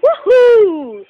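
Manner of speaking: loud high woohoo; cheer. Real, not acted. Cheering at outro.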